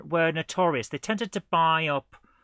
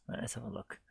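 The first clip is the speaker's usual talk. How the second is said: whisper; 3 semitones lower, 13 dB quieter, faster than usual. Real, not acted. Whispered aside.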